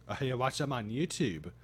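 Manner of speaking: ordinary talk, nothing marked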